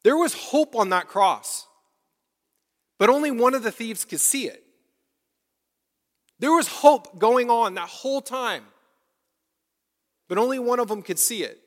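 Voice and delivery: rising pulpit fervor; full-force preaching. Acted. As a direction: preach it out to the whole church.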